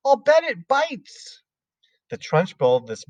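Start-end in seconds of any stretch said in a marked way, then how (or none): 0.1-1.4 put-on much higher, slow little-girl warning; high child's voice warning the headmistress; Lavender crying out. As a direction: call the warning like a small girl. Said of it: "cried Lavender".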